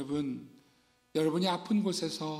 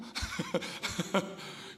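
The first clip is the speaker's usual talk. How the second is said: breathy laugh; 6 dB quieter than usual. Real, not acted. Laughs at own acting.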